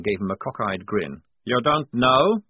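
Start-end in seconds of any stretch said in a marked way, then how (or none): 1.4-2.4 incredulous question. A narrator's reading. Prisoner surprised at officer's ignorance.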